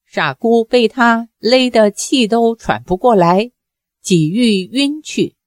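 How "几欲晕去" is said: plain reading voice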